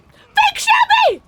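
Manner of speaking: very high, loud, drawn-out shriek; betrayed scream. Real, not acted. Player betrayed by Fake Shabby, outraged high scream. Screams at the impostor who hurt her.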